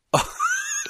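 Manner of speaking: high squealing laugh. Real, not acted. Laughs at wrong answer.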